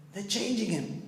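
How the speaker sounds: high-pitched, amazed retold cry; ecstatic exclamation. Passenger running up the carriage, mock ecstatic cry. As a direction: imitate an overjoyed onlooker, stretching the repeat. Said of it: "he came ecstatic".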